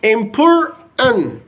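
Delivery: "important" slowed down, both T sounds dropped, as "impor'n"; slow American pronunciation. Shown as a demonstration. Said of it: "in American accent".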